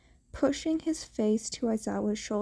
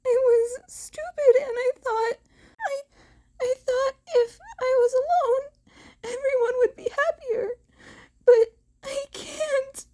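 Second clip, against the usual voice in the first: much higher, tearful sobbing plea; crying apology; 13 semitones higher, 4 dB louder than usual. Acted. Teen apologizing to Eraserhead through tears, crying hard. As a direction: sob it out, begging forgiveness.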